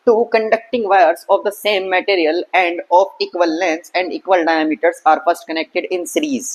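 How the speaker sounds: slow, careful textbook read-out; deliberate question reading; reading the question aloud. Shown as a demonstration. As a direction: read each word out slowly for the class.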